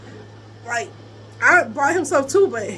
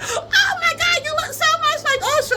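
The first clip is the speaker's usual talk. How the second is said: put-on high squealy gushing girls; high mock squeal of adoring women; mock fangirl gush; 14 semitones higher than usual. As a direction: imitate the swooning girls, way up high.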